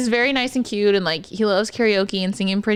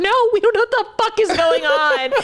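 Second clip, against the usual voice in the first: much higher, flustered denial; 13 semitones higher, 4 dB louder than usual. Retold or imitated. Therapist flustered denying to the patient, high. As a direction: blurt it out nervously. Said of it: "just trying to be like".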